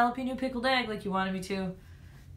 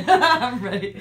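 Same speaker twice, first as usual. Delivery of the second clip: slow laughing; 2 semitones higher, 7 dB louder than usual. Real, not acted. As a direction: laugh through it.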